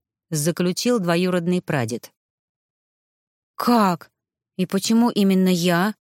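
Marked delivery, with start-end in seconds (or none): none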